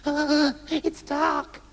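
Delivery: a timid man shrinking from the dark; scared man. A narrator's reading. The lost man tells Trousers he is scared of the dark. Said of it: "said the man".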